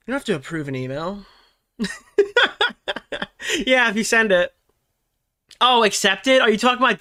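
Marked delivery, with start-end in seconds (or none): 1.8-3.6 short high laugh; amused laugh. Real, not acted. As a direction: laugh at the confusion. Laughs at chat confusion.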